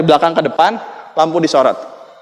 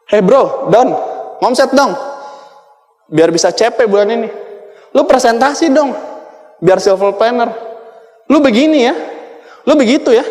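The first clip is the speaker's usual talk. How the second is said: pushy upline; 5 semitones higher than usual. Retold or imitated. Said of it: "Ngomongnya begitu".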